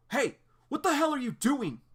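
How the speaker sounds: high, loud furious shout; angry shout. Acted. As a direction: yell at him across the field. John catching Adrian calling.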